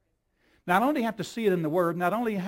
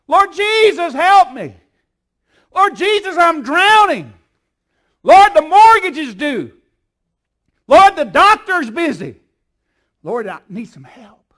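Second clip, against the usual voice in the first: loud pleading cry, pitched high; desperate crying out; 8 semitones higher, 15 dB louder than usual. Retold or imitated. Praying believer crying out loud to Jesus, desperate shout. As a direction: cry out to the Lord like you're drowning.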